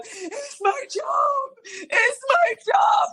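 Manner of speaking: high, drawn-out sobbing wail; hammed-up tearful wail; mock bawling. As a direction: act out bawling down the street, stretching each word.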